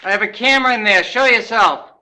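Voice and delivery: loud, high call into the dark. Real, not acted. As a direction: call out to the spirit loudly. Calling out to spirit in crawlspace.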